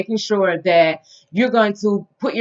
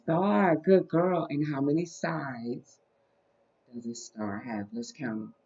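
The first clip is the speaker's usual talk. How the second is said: put-on soft, slow voice for a small child; reading to a toddler; 3 semitones lower, 12 dB quieter, slower than usual. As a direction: count gently with a toddler. Teaching toddler shapes.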